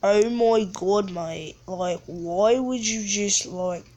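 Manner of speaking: put-on British accent. Gamer questioning an opponent's move, mock British accent. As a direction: complain like a mock Brit. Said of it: "talking in a British accent".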